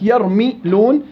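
"يرمي لون" slowly in parts, mi with an i vowel; mim with kasra. Shown as a demonstration. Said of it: "الميم تقبل الثلاث حركات". («يرمي لون» بكسر الميم مقطعة وببطء)

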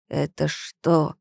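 slow, low chilling rasp; icy hoarse voice. A narrator's reading. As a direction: ask it slowly with a cold hoarse edge. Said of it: "спросил он с леденящей хрипотцой".

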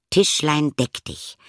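magic command. A narrator's reading.

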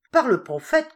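loud, high exclamation from the caliph; caliph crying out. A narrator's reading. Caliph cries out in delight to the court, loud and high. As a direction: call it out proudly. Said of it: "cria le calife".

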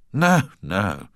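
ordinary delivery, nothing marked

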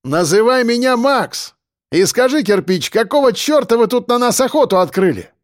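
high, projected challenge; demanding call. Acted. Defender questioning the convict about the attack, demanding call.

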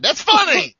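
high, loud protest; insistent outburst. Real, not acted. Cohost defending his Russian owner joke, loud insistent protest.

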